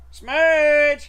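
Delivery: loud high call across the yard; calling the dog. Real, not acted. Owner calling his dog over from a distance, loud high call. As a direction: shout her name out to fetch her.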